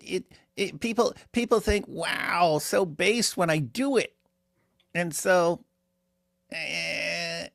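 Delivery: high, stammering cartoon braggart; squeaky South Park-style voice, fumbling for words; Myron. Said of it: "that's the voice that is in my head for what he would be like".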